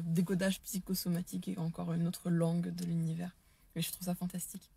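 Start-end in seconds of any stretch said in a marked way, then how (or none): 3.8-4.7 whisper. Real, not acted. Whispered aside.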